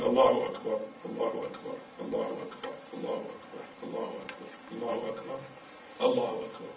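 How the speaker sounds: very soft, murmured series; low-energy recited sequence; hushed repetition. Shown as a demonstration. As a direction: repeat it under the breath, evenly. Demonstrates seven repeated takbirs.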